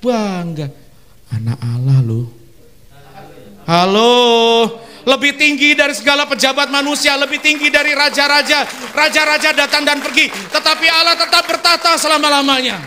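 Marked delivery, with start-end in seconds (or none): 3.6-12.8 high, loud proclaiming; triumphant pulpit shout; preaching climax. Acted. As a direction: lift the hall with it.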